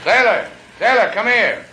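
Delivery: loud high call across the boat; shouting for sailor. Acted. Skipper calls his deckhand from the wheel, loud shout. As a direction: bellow for the deckhand.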